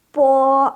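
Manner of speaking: po with a strong puff of air, high and level; aspirated po. Shown as a demonstration. Teacher modeling aspirated po for class. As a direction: say po pushing air out, not like B. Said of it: "Tapi B itu dibacanya".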